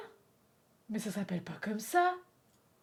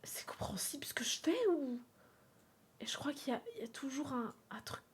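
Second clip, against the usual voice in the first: whisper; 10 dB quieter than usual. Real, not acted. Whispered aside while drawing.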